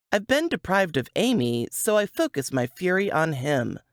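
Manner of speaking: ordinary delivery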